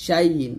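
শাই ইন said as one word, shai-yin; hamza turned ya. Shown as a demonstration. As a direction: let ইন start with a y sound straight out of শাই. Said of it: "হামজাটা আমরা ইয়াটের মতো করে".

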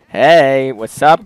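ordinary talk, nothing marked

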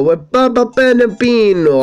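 mock Italian. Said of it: "I am saying random Italian".

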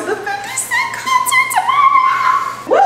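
high, slow, sung-out excitement; excited announcement. Real, not acted. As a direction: announce it like big news.

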